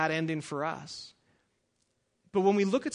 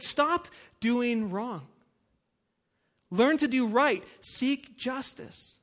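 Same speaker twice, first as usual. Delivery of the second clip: forceful scripture reading; 3 semitones higher than usual. Acted. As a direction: thunder out God's rebuke. Reads Isaiah at full force.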